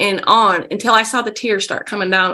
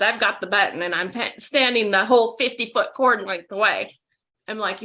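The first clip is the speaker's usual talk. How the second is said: ordinary delivery, nothing marked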